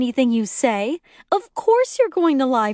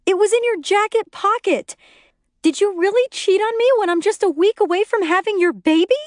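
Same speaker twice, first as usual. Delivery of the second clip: high, loud hysterical shriek on the edge of tears; hysterical screaming; 12 semitones higher, 3 dB louder than usual. A narrator's reading. Pregnant wife screaming at her husband. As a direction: scream it, beside herself. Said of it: "She's hysterical, crying, screaming".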